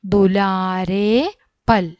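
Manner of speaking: drawn out syllable by syllable; very slow model word. Shown as a demonstration. Teacher answering her own question very slowly. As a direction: stretch it so they can follow. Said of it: "എന്താണ്?".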